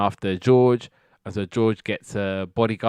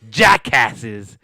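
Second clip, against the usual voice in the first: mock insult; 8 dB louder than usual. Acting out George's insult punchline.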